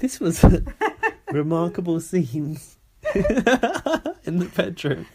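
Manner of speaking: words stretched around bursts of laughter; breaking up laughing. Real, not acted. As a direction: try to announce it while cracking up. Cracks up reading notes.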